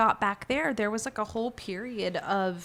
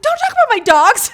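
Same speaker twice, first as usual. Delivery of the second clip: much higher, loud angry shout; defending dogs; 15 dB louder than usual. Retold or imitated. Defending her dogs from a stranger, loud angry shout. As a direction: snap back hard for your dogs. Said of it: "I'm like".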